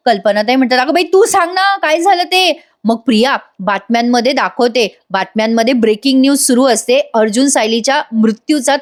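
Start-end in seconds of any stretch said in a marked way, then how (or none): 0.8-2.6 high, anxious plea for news; acted pleading. Kalpana pleads with Priya to tell, acted anxiety. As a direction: imitate a worried mother begging to be told.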